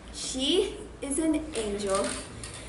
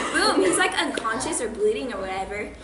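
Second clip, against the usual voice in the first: ordinary talk, nothing marked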